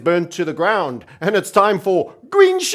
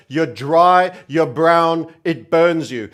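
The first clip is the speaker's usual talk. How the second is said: normal talking voice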